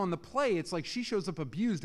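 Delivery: ordinary delivery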